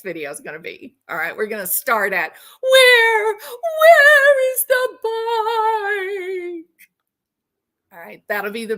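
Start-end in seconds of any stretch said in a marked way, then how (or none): 2.6-6.7 very high, loud, slow howl; mock wail. Storyteller teasing the next video's start, mock wailing. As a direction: wail it out, hugely drawn out and hammed-up. Repeats the acted wail as teaser.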